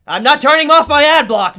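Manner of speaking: high, very loud refusal; defiant shout. Real, not acted. Refusing a website's demand.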